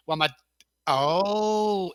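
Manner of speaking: normal talking voice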